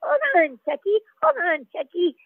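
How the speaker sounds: very high cartoon baby voice; the cartoon baby calling to a friend. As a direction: pipe it up like a toddler. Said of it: "I was being like".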